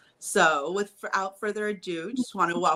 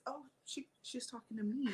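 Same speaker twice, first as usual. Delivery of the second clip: very soft, hesitant aside; retold dawning realization; 5 semitones higher, 11 dB quieter than usual. Retelling her thought after looking around, soft realization. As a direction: realize it quietly, a little baffled. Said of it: "looked around and didn't see anybody else and realized".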